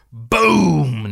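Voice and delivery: loud, high, drawn-out explosion word; huge comic-book boom; big boom. Acted. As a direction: let the boom burst out big and long. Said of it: "this is a big boom".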